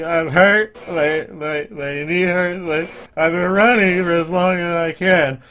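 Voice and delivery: put-on high, loud, tearful little boy; crying child voice. Game master voices the injured boy to the party, tearful and high. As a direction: whimper it like a hurt kid. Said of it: "the tears streaming down his eyes".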